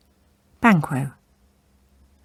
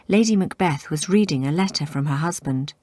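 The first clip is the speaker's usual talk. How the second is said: ordinary delivery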